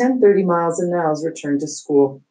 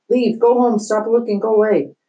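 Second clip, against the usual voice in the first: higher, louder, frantic yell at the students; principal yelling; 6 semitones higher, 4 dB louder than usual. Acted. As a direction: shout at the crowd to go home.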